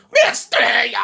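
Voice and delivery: high crying shout of Mi estrella, hammed up; mocking fake stepfather. Mocking the fake stepfather wailing at the hospital, a high crying shout. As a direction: wail it like a fake grieving stepfather. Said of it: "he was all like".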